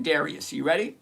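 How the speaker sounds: usual speaking voice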